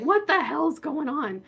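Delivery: imitating a dad bursting out of bed; dad's alarmed shout. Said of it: "he's running out like".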